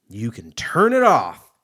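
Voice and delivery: loud, slow challenge; defiant. Real, not acted. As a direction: throw it at the audience loudly. Defiant about drinking on the podcast.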